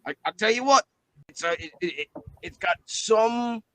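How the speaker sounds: a hammed-up New Zealand bluey voice; New Zealand accent. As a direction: lay on a broad Kiwi bloke accent. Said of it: "my New Zealand bluey accent".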